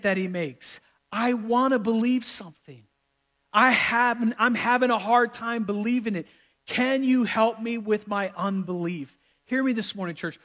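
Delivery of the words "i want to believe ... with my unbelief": earnest, higher-pitched appeal; vulnerable plea. Acted. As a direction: plead like a struggling father admitting doubt. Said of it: "look at the father's vulnerability".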